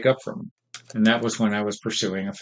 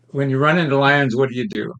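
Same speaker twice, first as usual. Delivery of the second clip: ordinary delivery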